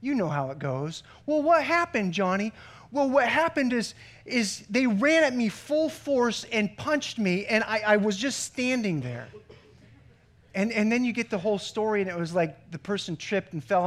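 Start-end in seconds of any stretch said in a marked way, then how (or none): 1.3-2.5 higher, louder questioning parent. Retold or imitated. 2.9-9.3 put-on child voice. Child giving his excuse.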